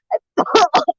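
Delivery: sudden cough from a swallowed fly. Real, not acted. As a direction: cough it out, startled. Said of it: "a fly just flew into my mouth".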